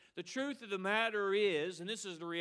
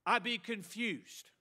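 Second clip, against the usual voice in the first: normal talking voice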